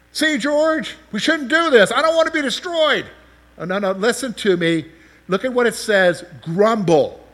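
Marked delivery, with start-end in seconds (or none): 0.1-3.1 high, loud, hammed-up fearful voice; mock scared churchgoer. Preacher mimics a frightened churchgoer, high and hammed-up. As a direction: play a jittery congregant begging his friend to stop.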